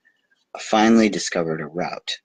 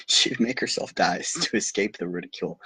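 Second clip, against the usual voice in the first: amused, softer retelling with giggles; laughing through it; 3 dB quieter than usual. Real, not acted. Reader laughing at the flower's drama, giggling. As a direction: chuckle through the line. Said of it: "laughing while retelling".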